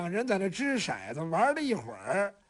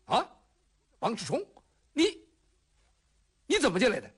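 startled. Acted. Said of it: "白水药当时就一惊".

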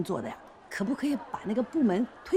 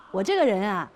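ordinary talk, nothing marked

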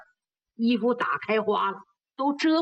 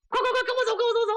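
high, rapid-fire urging; excited, high-pitched "come on" chatter; urgent hustling; 12 semitones higher, much faster than usual. Acted. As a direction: hurry someone off at once. Urging man to come along.

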